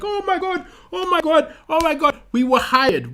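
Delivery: high, agitated lament; making a big fuss. Retold or imitated. Workers complaining to the brother, making a big fuss. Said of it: "making a big fuss".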